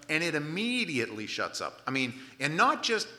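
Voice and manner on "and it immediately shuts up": ordinary delivery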